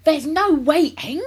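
loud, high and drawn out; overexcited father refusing any delay; worked-up cry. A narrator's reading. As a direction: cut in, bursting with excitement. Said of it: "cried Mister Cranky, working himself up".

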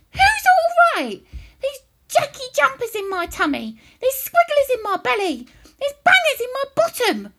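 loud, high-pitched furious complaint; old woman yelling. A narrator's reading. Grandma telling George about her jumping tummy, shrieking. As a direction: screech out every ailment indignantly. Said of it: "she yelled".

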